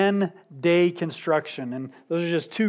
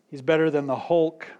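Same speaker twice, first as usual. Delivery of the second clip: ordinary talk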